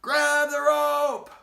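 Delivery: high, loud, drawn-out shout; shouted order. Acted. Rescuer in helicopter yells to the man below, loud slow shout. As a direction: yell it down from the helicopter. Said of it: "bringing it down to him and saying".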